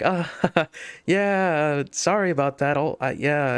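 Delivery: high, awkward laughing apology; nervous laughter. Retold or imitated. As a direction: imitate yourself nervously chuckling through sorry. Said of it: "I'm just a nervous smiler and laugher".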